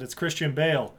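ordinary talk

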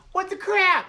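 very high, loud mock whine; whining weenie. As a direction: squawk it like an indignant weenie. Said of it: "he sounds like such a weenie".

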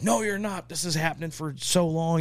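high-pitched mock scolding; imitated mad wife. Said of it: "she's so mad".

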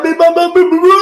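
rapid high-pitched nonsense syllables; mock outburst running up high; imitated spluttering. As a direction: babble out a flustered man's bluster. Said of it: "el tipo hizo así, va, se relajó".

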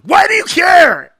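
loud, high, drawn-out mock shout; touchy Sam. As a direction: explode in mock indignation. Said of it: "He's like".

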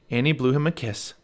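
plain reading voice

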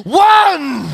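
mock ring count, shouted; referee's count. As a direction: call it out like a referee. Retold referee's count over fallen boxer.

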